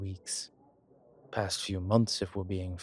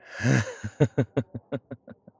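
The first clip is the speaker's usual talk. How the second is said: long, rolling chuckle; higher, louder rippling laugh; amused laugh; 4 semitones higher, 7 dB louder than usual. Acted. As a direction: laugh loudly in steady ripples. Vampire amused by human science.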